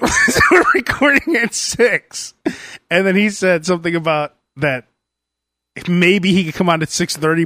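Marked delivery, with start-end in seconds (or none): 0.1-2.3 high, drawn-out words through laughter; laughing. Real, not acted. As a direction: laugh your way through the line. Laughs while retelling.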